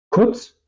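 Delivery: Kurz with a short, quick U; short vowel. Shown as a demonstration. Teacher models Kurz for learners, short vowel. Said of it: "la vocal también suena corto".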